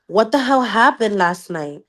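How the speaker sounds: ordinary talk, nothing marked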